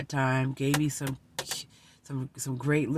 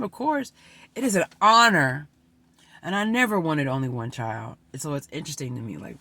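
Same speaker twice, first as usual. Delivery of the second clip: ordinary talk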